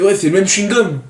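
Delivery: high proud student. Retold or imitated. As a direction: brag it up high. Student voiced bragging.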